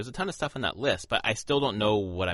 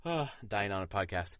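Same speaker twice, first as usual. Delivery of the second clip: ordinary delivery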